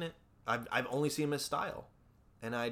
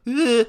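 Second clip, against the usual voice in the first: high, loud disgusted "ugh"; cringing "ugh"; 11 semitones higher, 15 dB louder than usual. Retold or imitated. Recalling first sight of friend's boots, cringing groan. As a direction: blurt a revolted noise. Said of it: "I cringed".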